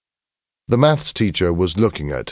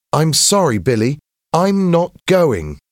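high, louder, firm refusal; refusing schoolboy; 11 semitones higher, 5 dB louder than usual. A narrator's reading. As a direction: refuse a friend firmly in a boy's raised voice. James refuses his friend.

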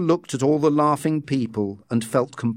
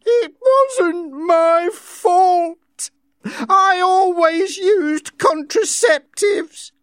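high, very slow, crying; 5 dB louder, much slower than usual. A narrator's reading. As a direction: sob it out in shame. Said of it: "began to cry".

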